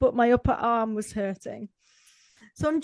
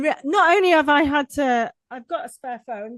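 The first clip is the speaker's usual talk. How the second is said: usual speaking voice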